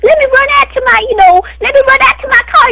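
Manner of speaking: high, fast mock girl voice; mocking Casey. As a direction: ape her in a squeaky, rushed voice. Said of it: "she run".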